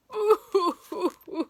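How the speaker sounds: high, loud hammed-up sob; loud boohoo wail; mock wail. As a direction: blub like a cartoon crybaby. Family sobbing again.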